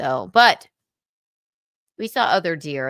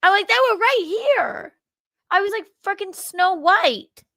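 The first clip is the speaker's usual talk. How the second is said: high giddy gushing mimicry; mocking gushing voice; 11 semitones higher, 3 dB louder than usual. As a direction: squeal it like a giddy princess. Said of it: "he presses send and goes".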